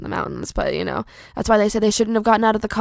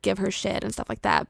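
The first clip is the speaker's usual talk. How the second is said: ordinary delivery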